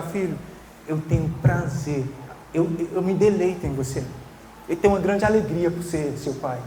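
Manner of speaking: gentle fatherly pride; tender father. Retold or imitated. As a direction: say it to your son with quiet delight. Father telling child his delight.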